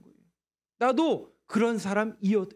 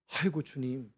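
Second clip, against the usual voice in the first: soft, lower lament; mournful sigh; 8 semitones lower, 7 dB quieter than usual. Acted. David lamenting before the Lord, soft sigh. David's sighing prayer voiced.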